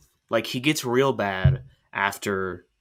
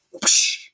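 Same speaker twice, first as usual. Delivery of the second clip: voiceless rushing whoosh; whoosh noise; 4 dB louder than usual. Retold or imitated. Friend imitating the flying carriage, breathy whoosh. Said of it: "the carriage goes like".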